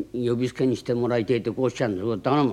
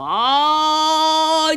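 long, high drawn-out call; very slow, loud boatman's cry; 12 semitones higher, 7 dB louder, much slower than usual. Shown as a demonstration. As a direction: sing it out across the water. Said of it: "ってなことを言います".

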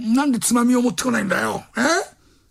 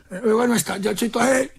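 ordinary talk, nothing marked

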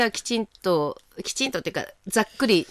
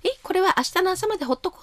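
高い声で早口に再現; 声を高く上げて早口で提案をまねる; 相手の提案をまねる; 11 semitones higher, faster than usual. Retold or imitated. 言われた言葉を再現. (高く早口な提案の再現)

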